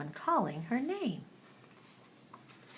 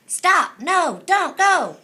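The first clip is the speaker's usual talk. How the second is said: shouting; 10 semitones higher, 10 dB louder, much slower than usual. Acted. Squirrel shouts a warning.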